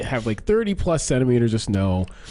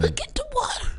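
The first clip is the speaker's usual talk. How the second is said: put-on high, whimpering frightened old lady; scared grandma voice; 4 dB quieter than usual. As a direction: cry it out like a petrified granny. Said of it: "that's my, uh, scared grandma face".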